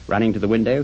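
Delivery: ordinary delivery, nothing marked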